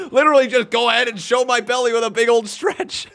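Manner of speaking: talking while laughing; amused, laughing through it. Real, not acted. Laughing at own stretch.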